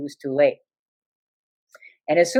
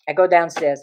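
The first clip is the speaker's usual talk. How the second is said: ordinary delivery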